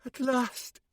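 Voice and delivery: ordinary delivery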